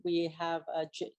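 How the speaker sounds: normal talking voice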